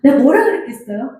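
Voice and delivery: usual speaking voice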